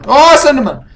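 sudden high scream of surprise; startled shout. Real, not acted. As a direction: yell out in a jolt of fright. Jump scare in horror game.

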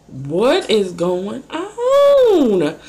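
very slow, stretched question; lingering puzzled wonder; drawn-out bewilderment. Retold or imitated. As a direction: drag every word out in confusion. Retelling her confusion.

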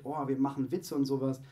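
ordinary delivery

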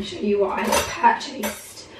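ordinary talk

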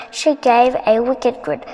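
usual speaking voice

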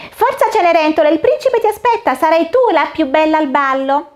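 a cheerful fairy godmother casting a spell; fata smemorina. Acted. Fairy voiced in story. (voce alta e allegra da fata madrina)

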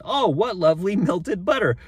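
high, loud storybook delight. A narrator's reading. Father finding melted butter, high delighted voice.

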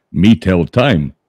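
mock Indian voice. Joke teller voicing the lying Indian, slow and stilted. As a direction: drag each word out, deadpan.

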